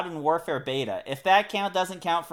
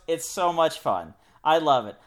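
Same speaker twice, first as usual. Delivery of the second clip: ordinary talk, nothing marked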